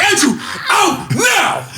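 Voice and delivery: high, slow, loud command; a teacher kicking out a bully; teacher shout. Retold or imitated. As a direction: throw him out of class. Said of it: "the teacher's like".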